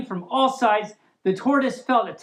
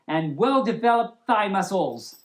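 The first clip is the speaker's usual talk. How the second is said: plain reading voice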